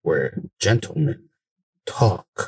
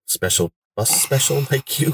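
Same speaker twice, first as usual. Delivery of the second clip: laughing; 5 semitones higher than usual. Real, not acted. Teasing friend, laughing mid-line.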